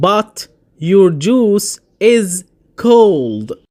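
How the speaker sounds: but your juice is cold, very slow; drawn out for beginners; slow example. Shown as a demonstration. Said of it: "مثال". (ببطء شديد للمبتدئين)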